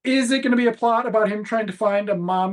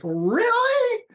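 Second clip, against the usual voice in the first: incredulous "Really?". Retold or imitated. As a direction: leap way up in pitch, mock-shocked disbelief. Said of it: "she's like".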